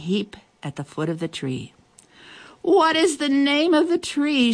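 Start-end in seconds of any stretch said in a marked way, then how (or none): 2.6-4.5 high, slow shouted question; shouting. A narrator's reading. Hungry animals calling to the gazelle, high shout. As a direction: call out the question eagerly. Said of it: "shouted the animals".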